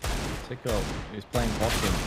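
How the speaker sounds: ordinary talk, nothing marked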